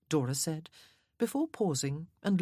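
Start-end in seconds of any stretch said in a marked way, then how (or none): none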